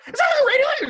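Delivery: high, loud astonished question; amazed disbelief. Retold or imitated. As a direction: shriek it like you can't believe it. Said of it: "people would look at my portfolio and be like".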